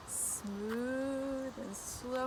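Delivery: very slow, soft and stretched; drawn out slowly. Shown as a demonstration. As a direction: stretch the words out softly and slowly. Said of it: "They move very".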